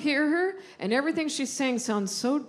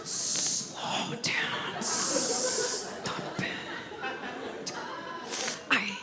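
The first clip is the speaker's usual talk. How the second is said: unvoiced whispered "Slow down, stop it"; imitating mom's whisper; 5 semitones higher, 8 dB quieter, much slower than usual. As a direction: whisper it like a nervous mother. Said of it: "she whispers everything about my driving".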